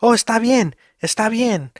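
high-pitched imitation of a child; imitating sleep-talking daughter. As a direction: mimic your daughter agreeing in her sleep. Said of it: "she'd be like".

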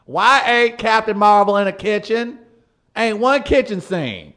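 loud, exaggerated complaining persona. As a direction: rant like an angry caller. Mock bigot persona continues.

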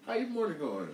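mock the girl's sweet greeting; high girly mimic. Girl's greeting imitated a second time.

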